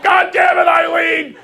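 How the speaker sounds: loud, high, furious holler; yelling husband. Retold or imitated. Injured neighbor on the floor blames his wife, yelling. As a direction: drag out the blame in a high mock shout. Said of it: "watch him yell at his wife".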